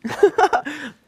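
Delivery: high, loud burst of laughs; burst of laughter. Real, not acted. Reacting to the host's teasing.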